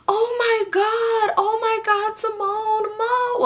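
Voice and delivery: very high, slow, squealing half-cry; squealing little sisters. Retold or imitated. Sisters squealing over the phone in a high, excited voice. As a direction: squeal it like thrilled little girls.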